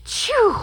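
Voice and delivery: loud high acted sneeze; hammed-up sneeze ending a fake ah. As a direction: burst out with a big loud sneeze. Gerald sneezes.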